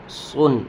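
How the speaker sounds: سن with a heavy, full s; emphatic Arabic saad in سن; sound of saad. Shown as a demonstration. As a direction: fill the mouth on the s of سن. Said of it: "اور صاد کی آواز آئے گی".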